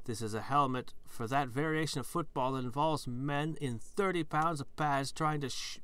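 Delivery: usual speaking voice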